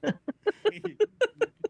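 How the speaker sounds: loud high burst of laughter. Real, not acted. DM laughing at the scene.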